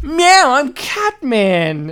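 high loud meow, then slow drawn-out reveal; Catman. Pretend. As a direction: purr the name like a smug cat. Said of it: "he's like".

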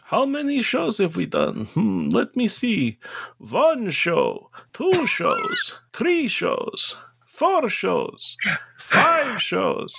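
high, gruff Western New York counting; drawn-out character voice counting shows; McKenneth voice. Acted. Echoes the question in character, counting.

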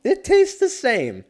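loud, high, slow mock declaration; shouting it like a silly announcer; high hammed-up voice. Repeating line in mock voices.

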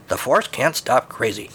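ordinary delivery

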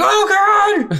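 very high, loud, laughing screech; panicking cyclops. Retold or imitated. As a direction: squeal in hammed-up terror at the hero. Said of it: "and he's like".

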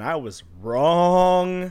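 WRONG stretched out long and held; comic emphasis, dragging out WRONG; drawn-out admission. Real, not acted. Comically owning up to failed predictions.

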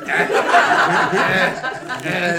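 loud repeated mimicked grunts, laughing; imitated noisy grunts. Friend peeing in a bottle noisily, imitated grunts. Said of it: "all those noises he makes".

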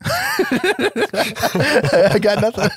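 slow words through real laughter; laughing. Real, not acted. To cohost, giving up through laughter. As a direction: laugh it off, out of answers.

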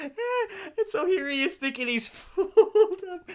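slow, high, crying with laughter; laughing hard. Real, not acted. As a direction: barely get it out through laughter. Narrator breaks up laughing.

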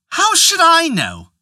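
high, slow, defiant wife's voice; wife's retort. Acted. A wife snaps back at her husband, high and slow. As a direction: toss the question back at him, pitched high. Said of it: "asked the wife".

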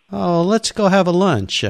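breezy Italian staff. Retold or imitated. Staff inviting the frustrated customer to lunch, high and carefree. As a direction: wave off his demand with a smile. Factory staff voiced in story.

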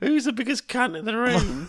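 high, loud Cockney club-host voice; loud, high Cockney host greeting the room; Cockney compere. Acted. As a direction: play a brash Cockney compere. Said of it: "I went to Cockney then".

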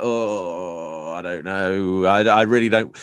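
long drawn-out hesitant groan into words; reluctant hesitation. Retold or imitated. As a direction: squirm out of saying no, retold. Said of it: "And I'm like".

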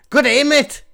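loud, high-pitched mock-Australian greeting; over-the-top Aussie call, way up high; bad Australian accent. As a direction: shout it in a badly faked Australian accent. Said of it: "I was going for Australian".

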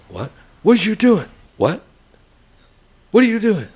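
high, loud mock yell at a stranger; retold yell. Neighbor yelling at a dad walking past her house. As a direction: shout it out from the porch. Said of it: "The neighbor's yelling at you".

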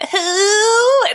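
high, strained, deliberately awful "Who?"; really bad voice. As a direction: squeak out "Who?" like a broken weirdo to scare him off. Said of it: "in, like, a really bad voice".

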